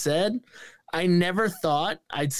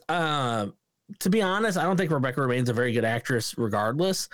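ordinary talk

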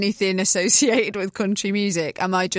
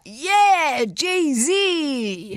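high, bright fan cheer; excited cheer; 6 semitones higher than usual. Retold or imitated. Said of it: "See, I was like".

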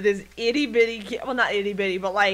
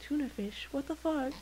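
soft, higher, puzzled question; mock bafflement; 6 semitones higher, 10 dB quieter than usual. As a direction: ask it quietly, as if confused by the cat. Baffled people reacting to the cat.

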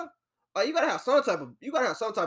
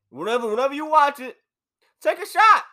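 called-out toast; 5 semitones higher, 5 dB louder than usual. Real, not acted. Calls out to viewers, toast.

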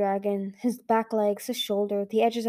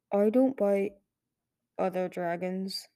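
low, slow, grave dragon voice, fading softer; a weary stone dragon stating a fact slowly; heavy old dragon, each word dragged out; deep serious voice; 3 dB quieter than usual. Acted. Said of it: "in a deep, serious voice".

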